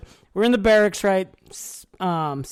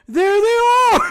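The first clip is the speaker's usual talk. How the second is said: much higher, louder shout from behind; calling out; 13 semitones higher, 7 dB louder than usual. Retold or imitated. Approaching group spotting the rivals, loud call. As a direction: yell it as you spot them.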